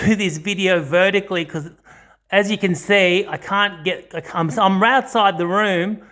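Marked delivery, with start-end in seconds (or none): none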